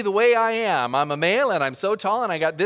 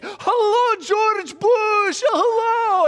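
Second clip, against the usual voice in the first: high, loud greeting called across the street; street shout; 7 dB louder, much slower than usual. Retold or imitated. Fruit sellers shouting to the pastor across the street, loud and high. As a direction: call it out to someone far away. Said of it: "they shout out across the street".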